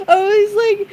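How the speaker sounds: laughing through the words; high, crying with laughter; words squeezed out while laughing. Real, not acted.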